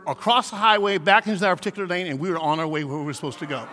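usual speaking voice

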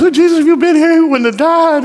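high, loud imitated lament; mock wailing. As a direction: wail it as the grieving sister, hammed-up. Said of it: "and she's wailing".

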